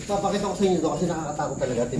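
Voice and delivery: low hushed voice in the dark. Real, not acted. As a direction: keep it hushed and quick. Exploring a dark, scary place.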